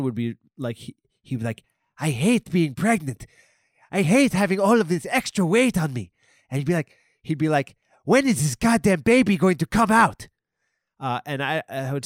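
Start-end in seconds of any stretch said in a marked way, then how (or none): 2.0-6.1 higher, louder whiny Ren complaint. Acted. Ren voiced in a comic bit. 8.0-10.3 higher, louder whiny Ren complaint. Acted. Ren voiced in a comic bit.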